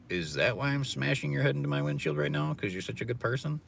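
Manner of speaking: normal talking voice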